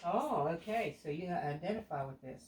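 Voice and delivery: ordinary delivery, nothing marked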